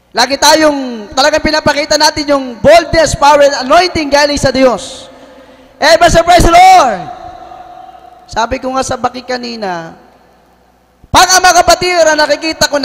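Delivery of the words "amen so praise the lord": loud, high rallying shout; shouted praise. Real, not acted. Preacher fires up the congregation, loud high shout. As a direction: lift the room with it.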